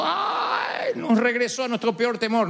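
high, whiny mock lament; mock wailing. Preacher mocking listeners who leave in tears. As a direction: parody a crying churchgoer. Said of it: "se vayan todos llorando".

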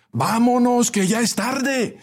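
very high, drawn-out call; shouting. A narrator's reading. Passenger in the back seats urging departure, shouting. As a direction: call out impatiently at a high pitch. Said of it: "gritó alguien desde los últimos asientos".

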